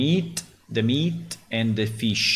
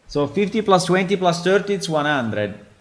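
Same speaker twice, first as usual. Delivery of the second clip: triumphant; 7 semitones higher, 6 dB louder than usual. Real, not acted. Triumphant sum after first try.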